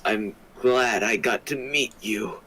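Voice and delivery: put-on deep voice for a girl character; low girl voice. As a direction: speak her lines low and steady. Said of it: "Why are you talking like that?".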